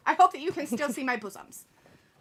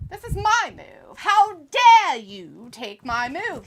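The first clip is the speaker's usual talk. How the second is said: hammed-up indignation, pitch jumping high; mock outrage; 5 semitones higher, 9 dB louder, slower than usual. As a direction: play an affronted wrestler, drawing out the challenge. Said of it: "angry at each other".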